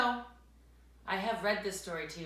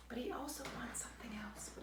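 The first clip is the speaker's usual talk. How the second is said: soft aside; 4 semitones higher, 12 dB quieter, much faster than usual. Real, not acted. Reader asks child quietly.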